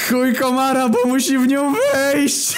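put-on loud, very high, drawn-out drunken guffaw; cackling at his own joke; roaring punchline. A narrator's reading. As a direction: shout the punchline, bursting into laughter. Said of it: "dokończył i zarechotał głośno".

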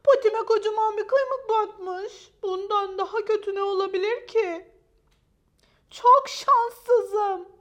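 high, loud self-pitying lament; grumbling to herself. A narrator's reading. Unlucky dog complains to herself about her paw, loud lament. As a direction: bemoan your rotten luck at full voice. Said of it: "diye söylendi kendi kendine".

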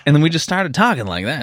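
ordinary talk, nothing marked

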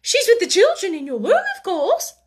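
high, nervous answer; flustered. A narrator's reading. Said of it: "she stammered".

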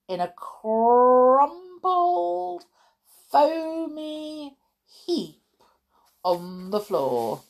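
very slow, high, stretched words; drawn-out relish. A narrator's reading. Describing the foamy pile-up, slow and drawn out. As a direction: savour each word, rolling the r.

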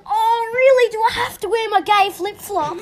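exaggerated put-upon complaint; mock whining. Acts out someone whining about sandals.

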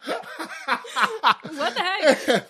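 slow laughing what the heck; laughing disbelief. Real, not acted. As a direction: drag it out through laughter.

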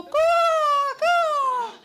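very loud, high crow cawing. Retold or imitated. Storyteller imitating the crow diving overhead, very loud high caws. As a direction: screech out the crow's call. Gulls turn out to be crows.

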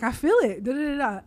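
retold loud burst of feeling. Telling the listeners how ready to fight she felt, loud. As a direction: belt it out like the feeling just hit. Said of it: "I'm like".

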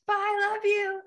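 high, loud sing-song goodbye called back into the house; calling goodbye. Retold or imitated. Leaving her parents' house, calling to everyone, a high loud sing-song. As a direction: sing it out to the whole family as you leave.

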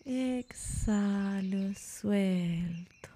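very slow, stretched words on a breath out; drawn-out release. Shown as a demonstration. Yoga teacher leading the exhale after a held breath, drawn-out release. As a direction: let each word sigh out long.

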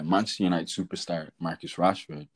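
ordinary talk

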